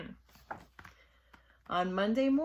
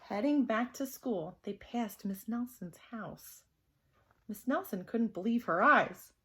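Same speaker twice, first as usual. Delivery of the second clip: ordinary delivery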